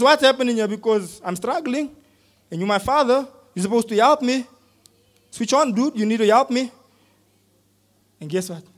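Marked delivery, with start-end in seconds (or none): 0.0-0.7 What's pushed higher and louder; pleading son pressing Dad, insistently; serious demand. Retold or imitated. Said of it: "Man, I'm serious". 0.7-6.7 louder, higher, pressing appeal; a son pressing his father for help, insistently; insistent demand to God. Retold or imitated. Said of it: "This is what".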